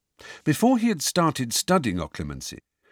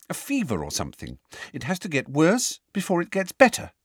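put-on worried girl voice; 8 semitones higher than usual. A narrator's reading.

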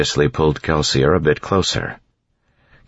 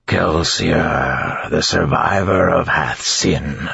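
slow, grinding creature voice; 3 semitones higher, much slower than usual. A narrator's reading. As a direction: grind out the words like stone. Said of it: "the creature said in a grinding voice".

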